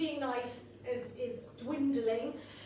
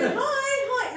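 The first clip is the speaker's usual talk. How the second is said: high chirpy hi; 10 semitones higher, 8 dB louder than usual. Retold or imitated. Manic greeting at school gate.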